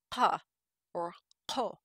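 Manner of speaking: "pa" and "ko" begun with a stop at the uvula; uvular stop. Shown as a demonstration. Lecturer showing a sound rare in Europe, uvular stops. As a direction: make "pa" and "ko" start far back at the uvula. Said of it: "uvular stop".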